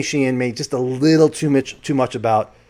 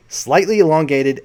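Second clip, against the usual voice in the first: ordinary delivery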